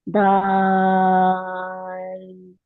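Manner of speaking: दाल with the long vowel held for six counts; six-count elongation. Shown as a demonstration. Teacher demonstrating a six-count madd on दाल. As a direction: stretch the आ in दाल very slowly. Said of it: "दा को six counts खींचेंगे".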